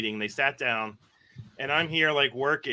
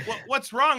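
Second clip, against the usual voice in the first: puzzled; 10 semitones higher than usual. Retold or imitated. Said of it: "And I was like".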